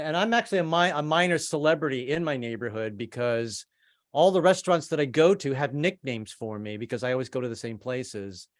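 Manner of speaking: normal talking voice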